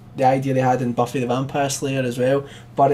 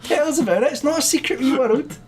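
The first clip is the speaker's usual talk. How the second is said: mock reassuring; 13 semitones higher than usual. Teasing a friend about anime.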